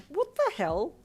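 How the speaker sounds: high, loud, incredulous question; exasperated yell. A narrator's reading. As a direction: demand it with rising disbelief. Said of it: "her sister yelled in exasperation".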